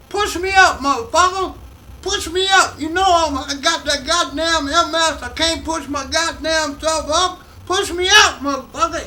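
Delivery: high-pitched, loud, raspy-edged mock yell; Richard Pryor yelling. Comedian imitating his idol yelling from the back, loud and high. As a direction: bellow it from the back of the room, half-laughing at the crude demand. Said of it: "He goes".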